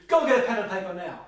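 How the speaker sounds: brisk, loud order, fast; firm loud command. Shown as a demonstration. Teacher demonstrating a blunt order, loud and brisk. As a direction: bark it like a firm instruction. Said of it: "let's say, for example".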